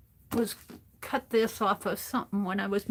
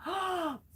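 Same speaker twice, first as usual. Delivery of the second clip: sharp gasp; 9 semitones higher, 5 dB quieter than usual. Real, not acted. Shocked by dental implants.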